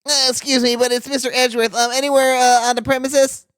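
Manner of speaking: timid nerd voice, pitch up. Acted. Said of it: "I'm gonna give you the nerd voice".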